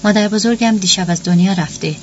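ordinary delivery, nothing marked

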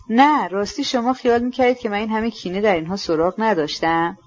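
usual speaking voice

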